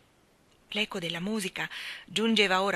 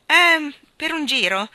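usual speaking voice

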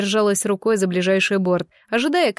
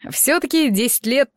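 lightly teasing, amused question; smirking; 4 semitones higher than usual. A narrator's reading. Young man teasing his companion after she grabs him, smirking. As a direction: tease with a touch of a smirk. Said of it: "усмехнулся парень".

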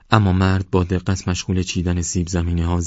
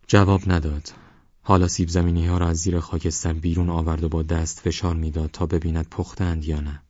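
usual speaking voice

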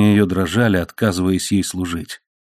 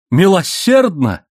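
high, drawn-out echo of the word; slow, high-pitched incredulity; incredulous exclamation; 9 semitones higher, 4 dB louder, slower than usual. A narrator's reading. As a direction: exclaim it in disbelief. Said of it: "воскликнула она".

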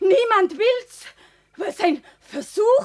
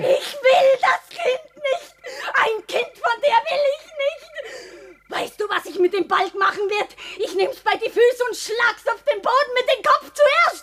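crying laughter through cruel words; hysterical laughing threat; 2 semitones higher than usual. Acted. Pregnant woman laughing hysterically about killing the child. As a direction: laugh madly while you threaten.

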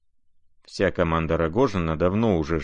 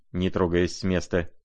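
plain reading voice

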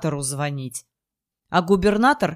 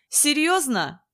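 angry; 7 semitones higher, slower than usual. A narrator's reading. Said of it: "от злости".

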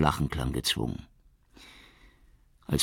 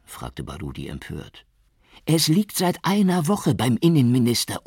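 higher, bitter complaint of the boss; lightly voiced chief; 8 semitones higher, 5 dB louder than usual. A narrator's reading. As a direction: vent to a colleague. Suleiman's voiced lines.

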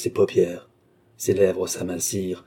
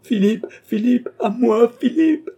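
very high, loud desperate calling; crying for help; 6 dB louder than usual. A narrator's reading. Young woman calling Philippe to her aid, high frantic cries. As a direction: scream the name for rescue. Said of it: "cria André".

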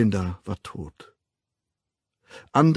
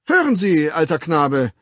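coaxing; 6 semitones higher, 7 dB louder than usual. A narrator's reading. Official coaxes the savage.

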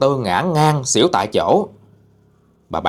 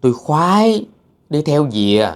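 lightly voiced coy ghost, raised pitch; ghost woman, smitten and coy; 4 semitones higher than usual. A narrator's reading. Ghost explains following the handsome man, lightly coy. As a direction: confess the crush in a girlish whine.

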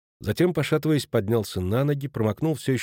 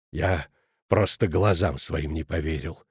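low, measured disbelief; shaken reproach; 7 semitones lower, 4 dB quieter than usual. A narrator's reading. Headmaster reproaching Schmidt, shaken. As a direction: start low, as if still taking it in.

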